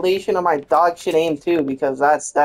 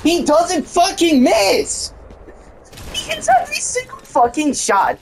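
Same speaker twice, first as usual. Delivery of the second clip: high, furious outburst at the enemy's aim; angry yell; 13 semitones higher than usual. Real, not acted. Gamer raging at enemy's aim, high angry yell. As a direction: rage at his perfect shots.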